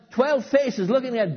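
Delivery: mounting alarm. Acted. Building to disciples' cry.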